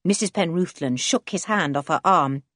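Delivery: ordinary delivery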